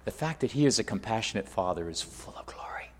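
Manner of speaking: hushed whisper of awe. Real, not acted. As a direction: breathe it out in a whisper.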